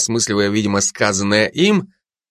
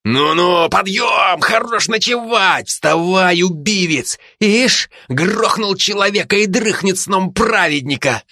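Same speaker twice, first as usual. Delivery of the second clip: rough wake-up shout; 9 semitones higher than usual. Acted. Zhanna rousing sleeper.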